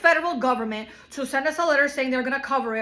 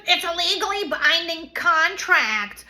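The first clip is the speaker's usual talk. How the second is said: snooty mocking lawyer; 5 semitones higher, 5 dB louder, slower than usual. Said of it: "she's like".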